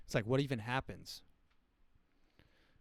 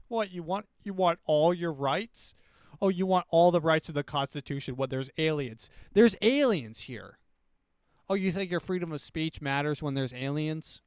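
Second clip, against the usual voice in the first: higher, louder sneering challenge; mock incredulous scolding; 4 semitones higher, 6 dB louder than usual. Mocking a citizen who still wants rights, incredulous and loud. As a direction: scoff at them for wanting rights.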